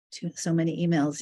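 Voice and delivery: ordinary delivery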